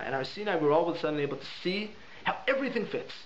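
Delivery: ordinary delivery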